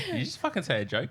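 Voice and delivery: high, fast disbelief; incredulous. Retold or imitated. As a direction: ask in shocked disbelief. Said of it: "you're like".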